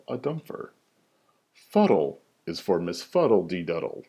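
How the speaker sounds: ordinary delivery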